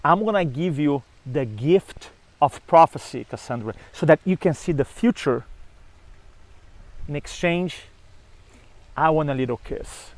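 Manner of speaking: soft, coaxing god making a deal; a gentle persuasive pitch, lightly voiced; coaxing offer. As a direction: sweet-talk her quietly into the bargain.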